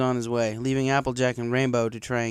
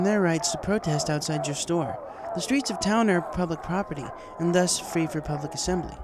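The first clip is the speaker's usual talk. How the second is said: higher, measured royal voice; regal princess; 7 semitones higher than usual. A narrator's reading. Celestia scolds the shopkeeper, firm and measured. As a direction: lecture with calm royal authority.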